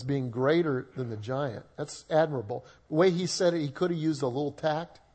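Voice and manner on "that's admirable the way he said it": ordinary talk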